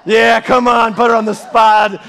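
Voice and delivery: loud, teasing cheer; playful shout. Pretend. Preacher urging Sarah to stand up, playful shout. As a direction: call it out loudly across the room.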